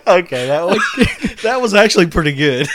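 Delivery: laughing and high; giggling reply. Real, not acted. Reacting to joke, laughing.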